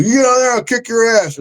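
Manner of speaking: high, mocking tough-guy challenge; retelling a roadside punk's dare; drunk guy's threat. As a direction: squeak out a cocky threat. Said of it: "and he goes".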